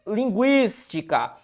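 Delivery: "Linguística" slow, with GUÍS held; stressed syllable stretched. Shown as a demonstration. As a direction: split "Linguística" after a long GUÍS. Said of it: "Qual que é a regra de linguística?". ("Linguística" devagar, alongando GUÍS)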